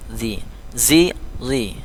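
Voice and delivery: "Zi, zi, zi": tongue-tip, buzzed, then heavy; held "Zi, zi, zi", each z distinct; contrasting z letters. Shown as a demonstration. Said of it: "ini agak kecil".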